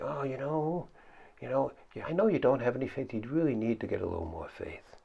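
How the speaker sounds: soft, gentle, lightly voiced Jesus; compassionate. Jesus gently reassuring his frightened disciples. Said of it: "Or maybe he was compassionate".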